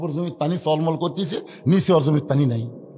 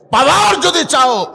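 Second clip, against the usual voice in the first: rousing exhortation; 6 dB louder, slower than usual. Real, not acted. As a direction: belt out the plea, then settle heavily. Preacher's climactic exhortation to crowd.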